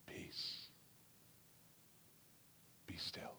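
a hushed, tender command of peace; calm whispered command. Acted. Jesus calms the storm, gently whispering. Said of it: "he calmly and gently says".

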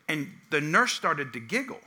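ordinary talk

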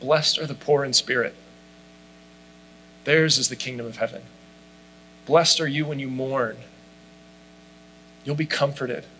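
soft, tender blessing read; gentle and kind. Shown as a demonstration. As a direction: speak each blessing gently, like a friend comforting. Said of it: "it would look a little bit more like this".

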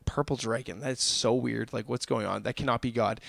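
low, hushed inner doubt, half to yourself; the congregation's own sceptical thoughts, hushed doubting murmur. Retold or imitated. Listeners' imagined doubt over a strange word, muttered low.

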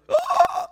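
high-pitched startled "ah"; mock shocked cry. Preacher imitating his congregation's reaction, high mock shocked cry. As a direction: give a short, mock-shocked squeal. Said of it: "some of you are gonna go".

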